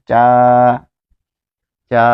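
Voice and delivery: চা drawn out and repeated; teacher demo of a long চা; vowel drill. Shown as a demonstration. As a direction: stretch the আ in চা. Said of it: "এখানে কি হবে?".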